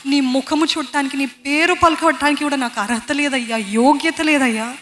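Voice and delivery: humble prayer. Retold or imitated. The tax collector crying to God, humble slow pleading. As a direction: pour out unworthiness before God. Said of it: "తగ్గింపుతో, దీనత్వంతో ఆయన సన్నిధికి వచ్చి".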